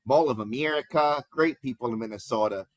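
mock Minnesota accent; bad Minnesota accent. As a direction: badly imitate a Minnesotan accent. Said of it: "sorry for the bad accent".

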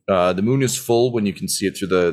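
ordinary talk

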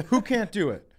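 usual speaking voice

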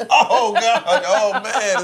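loud laughing gasps. Real, not acted. Laughing hard at the cookie texture. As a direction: crack up at the cookies.

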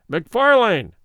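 loud herald's call up the stairs; somewhat loud. A narrator's reading. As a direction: announce his name across the hall. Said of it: "he said somewhat loudly".

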